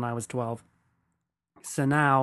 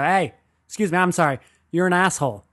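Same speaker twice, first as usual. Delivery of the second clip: higher, louder mock-polite callout; mock confrontation; 5 semitones higher, 6 dB louder, faster than usual. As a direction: flag a stranger down to tell him off, in play. Imagined confronting of the man.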